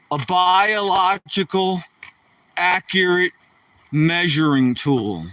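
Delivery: "biological... accurate... measuring tool" drawn out piece by piece; slow, mock-grand. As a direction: spell it out slowly with pauses for comic weight. Grandly restates his own joke.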